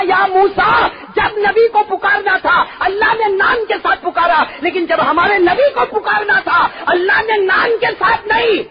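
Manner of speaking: high shouted preaching; climbing preacher's shout; fiery preaching. Acted. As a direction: call out each prophet's name at full force. Preacher shouting God's calls.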